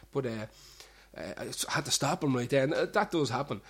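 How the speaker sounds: ordinary delivery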